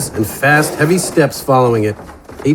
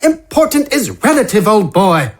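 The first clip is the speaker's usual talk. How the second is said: higher, theatrical gentleman voice; relishing the idea of explosives; Wither's voice; 9 semitones higher than usual. Acted. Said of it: "Wither said".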